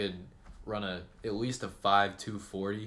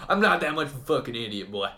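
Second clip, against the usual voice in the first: louder, higher mock indignation; irate caller; 3 semitones higher, 8 dB louder than usual. Caller refusing to give card details, hammed-up angry retort. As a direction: play an insulted customer snapping back. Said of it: "You're calling me because you're having a problem".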